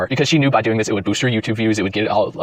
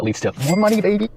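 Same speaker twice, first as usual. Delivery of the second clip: higher, gleeful sing-song; higher gleeful money, baby; smug payoff; 9 semitones higher than usual. Pretend. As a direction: savor the punchline about money. Same line replayed.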